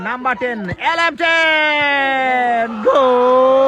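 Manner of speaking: drawn-out roaring goal call; goal shout. Real, not acted. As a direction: scream the goal long and loud. Goal called out in commentary.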